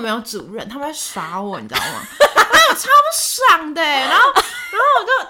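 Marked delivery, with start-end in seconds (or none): 2.2-5.3 laughing. Real, not acted. Laughing while recalling the prank.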